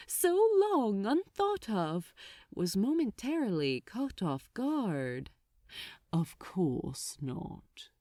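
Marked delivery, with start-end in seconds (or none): 6.1-7.9 low, soft, slow; smooth. Acted. Archdemon answering for Crowley, smooth and low. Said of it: "answered for him, smoothly".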